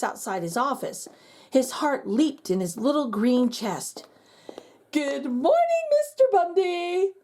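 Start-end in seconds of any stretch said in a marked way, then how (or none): 4.9-7.1 higher, calling through the door. Acted. Vice principal calls from outside the office, cheery call. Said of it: "called a voice".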